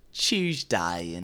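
London accent impression with a laugh; mock London accent. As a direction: parody a Londoner's pronunciation. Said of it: "London stereotype".

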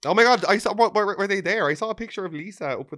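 high, loud eager rush; thrilled gossip rush; excited gossip. Real, not acted. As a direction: pounce on the gossip.

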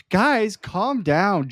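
quoting Jonah Hill calming the guys; mock soothing. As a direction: talk them down slowly.

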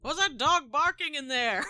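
high, loud joking voice; mock onlooker. Co-host joking about the yelps, high mock voice. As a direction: call it out in a put-on voice. Jokes back at the yelped helps.

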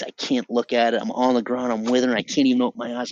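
ordinary talk

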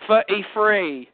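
Cockney "Thirty-three" as "firty-free". Shown as a demonstration. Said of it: "you turn a T H to an F".

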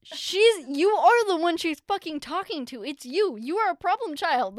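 loud, high, emphatic mock scolding; exasperated outburst. Daughter correcting mom about who teacher meant, loud exasperated outburst. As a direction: burst out in comic exasperation.

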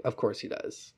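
unvoiced, dry aside; a hushed eye-roll; whispered sarcasm. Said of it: "he's like".

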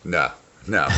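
ordinary talk, nothing marked

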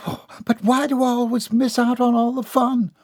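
high, whining protest; disappointed child. A narrator's reading. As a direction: plead like a child left out. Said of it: "not without severe disappointment".

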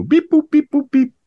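high, loud beeping robot; toy robot voice. Pretend. Robot noises for the review intro.

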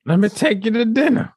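high, put-on voice; mock suitor. Adding to the homeless guy's bit, high mock suitor voice. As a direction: play the homeless guy sweet-talking Joe Biden. Adds the guy's next line.

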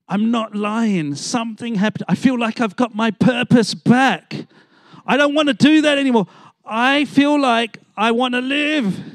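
put-on a kid who found his life again; high, eager, tumbling out; overjoyed. Student bursts in on Monday.

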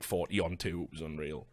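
normal talking voice

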